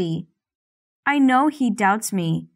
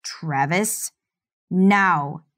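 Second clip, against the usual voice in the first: ordering; much slower than usual. Acted. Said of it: "he orders".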